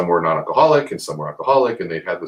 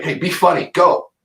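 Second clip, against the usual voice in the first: demanding viewer; 12 semitones higher, 7 dB louder than usual. Retold or imitated. Viewers demanding jokes, quoted.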